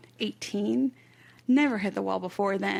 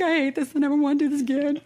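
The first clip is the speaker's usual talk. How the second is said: higher, louder, fast mock wail; retold crying; 5 semitones higher, 6 dB louder, faster than usual. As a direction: replay the meltdown as a frantic half-laughing wail. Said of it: "bawling my eyes out".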